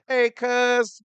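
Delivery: loud, high hailing greeting; calling out. Real, not acted. Host hails a cousin who is tuning in, calling out. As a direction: holler a hello to a relative.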